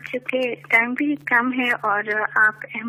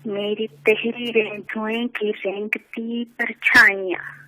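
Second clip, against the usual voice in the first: measured poetic recitation, lower; stately nazm recitation; 4 semitones lower, 4 dB quieter than usual. Acted. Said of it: "ایک نظم پیش کرنا چاہوں گی".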